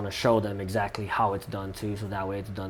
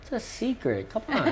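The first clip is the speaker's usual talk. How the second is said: playful teasing; 13 semitones higher than usual. Real, not acted. Teasing about the finale secret.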